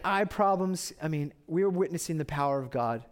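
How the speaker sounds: usual speaking voice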